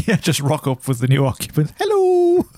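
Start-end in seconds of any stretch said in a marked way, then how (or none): 1.8-2.4 squeaky high hello; high mock greeting. Mock greeting to new occupants.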